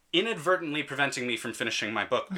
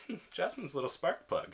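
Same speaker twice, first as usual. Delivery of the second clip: smirking aside; 6 semitones higher, 8 dB quieter than usual. Retold or imitated. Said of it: "I kind of smirked myself and was like".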